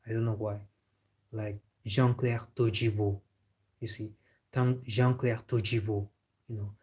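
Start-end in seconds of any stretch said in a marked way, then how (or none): 1.8-3.2 Todibo said as Tojibo, the D softened to a J sound; Jean-Claire Todibo with the French J-like D; French D as soft J. Shown as a demonstration. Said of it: "pronounce D like G". 4.8-6.1 Todibo said as Tojibo, the D softened to a J sound; Jean-Claire Todibo with the French J-like D; French D as soft J. Shown as a demonstration. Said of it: "pronounce D like G".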